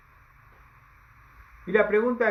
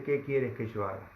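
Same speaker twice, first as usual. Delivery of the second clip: humble and quiet; 7 semitones lower, 6 dB quieter than usual. Acted. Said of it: "él dice".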